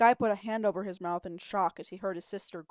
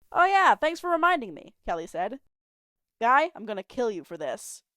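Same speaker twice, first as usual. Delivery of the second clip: sarcastic; 4 semitones higher, 7 dB louder than usual. Acted. Sarcastic sister threat.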